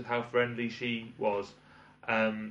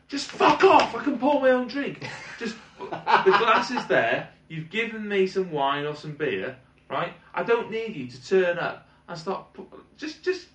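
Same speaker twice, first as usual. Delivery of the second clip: much higher and louder, ranting; exasperated rant; 9 semitones higher, 6 dB louder than usual. Real, not acted. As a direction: vent at pushy waiters, shrill with fury. Ranting at pushy waiters.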